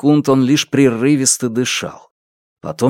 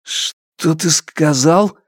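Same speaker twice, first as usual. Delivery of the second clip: slow, halting, higher-pitched shock; faltering voice; 5 semitones higher, much slower than usual. A narrator's reading. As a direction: let the question waver, drawn out slowly. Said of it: "Его голос дрогнул". (дрогнувшим голосом, медленно и растерянно)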